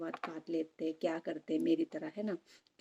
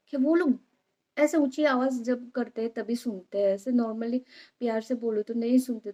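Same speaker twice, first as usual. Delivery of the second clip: 1 heated justification; 4 semitones higher, 8 dB louder than usual. Real, not acted. Defending her scolding, heated.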